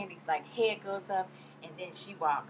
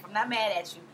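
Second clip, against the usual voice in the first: usual speaking voice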